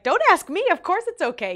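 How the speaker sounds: high, loud mock protest; emphatic reassurance. Rider telling her pool driver to go ahead, emphatic. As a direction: wave the question off loudly. Waving off driver's polite question.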